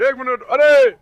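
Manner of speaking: loud, sudden hey; alarmed call. Real, not acted. Startled as the dogs scuffle, loud call. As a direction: cut in with alarm.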